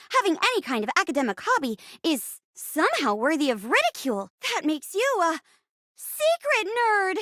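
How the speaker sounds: put-on quick teen reasoning climbing to a high reveal; nerdy girl, incredulous. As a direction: rattle it off, then stretch the accusation high. Plays nerdy girl working it out.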